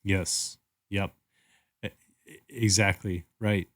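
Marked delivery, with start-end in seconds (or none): none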